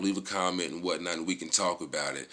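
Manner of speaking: normal talking voice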